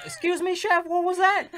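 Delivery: high, loud mock customer; puzzled diner. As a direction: call out to the chef in comic confusion. Acted diner questioning the chef.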